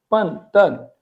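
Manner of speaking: "पन तन" with p and t, each syllable falling; two separated falling syllables, "पन" and "तन"; correct Chinese pronunciation. Shown as a demonstration. Teacher repeating the real reading, voiceless "पन तन". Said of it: "actually ये है".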